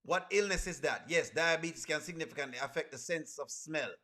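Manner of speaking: usual speaking voice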